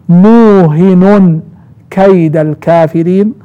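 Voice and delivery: "موهن" with long u, single h, ending in n, muuhinun; second reading. Shown as a demonstration. Teacher showing students the second reading, "موهن" as muuhinun. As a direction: recite "موهن" slowly as muuhinun, then "كيد" as kayda. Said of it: "القراءة الثانية قرأ ابن عامر وحمزة والكسائي".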